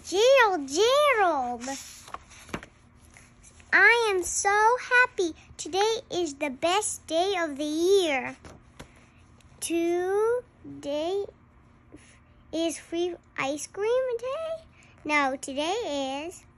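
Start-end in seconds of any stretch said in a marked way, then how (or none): none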